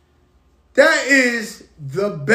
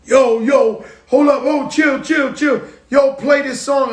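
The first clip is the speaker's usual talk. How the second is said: loud excited shouting, higher; 5 semitones higher, 4 dB louder than usual. Retold or imitated. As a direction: yell it like a hyped rapper in the studio. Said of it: "[name] does the [name]".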